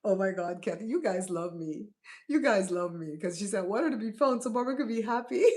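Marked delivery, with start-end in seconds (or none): 3.6-5.4 fast, higher, breaking into laughter; re-read the comment, tickled by it; amused quoting. Retold or imitated. Amused re-reading of a viewer's comment.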